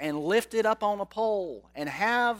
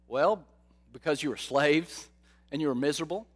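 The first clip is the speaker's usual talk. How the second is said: ordinary delivery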